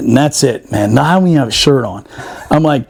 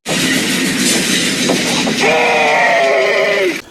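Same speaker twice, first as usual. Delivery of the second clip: helpless high-pitched cackle; a squeal of laughter that cracks up; 3 dB quieter than usual. Real, not acted. Storyteller cracking up at his own war story, squealing laugh.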